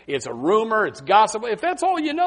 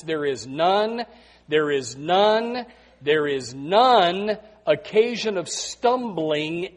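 "none" drawn out slowly each time; slow emphasis; much slower than usual. Shown as a demonstration. Preacher repeating scripture slowly for emphasis. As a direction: linger on "none" to drive it home. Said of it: "watch this".

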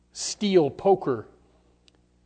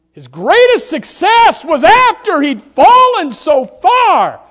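soaring high, loud climax of the sermon; preaching at full force; 13 semitones higher, 16 dB louder, slower than usual. Acted. Preacher to his congregation, ringing high loud preaching. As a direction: lift them up with a ringing cry.

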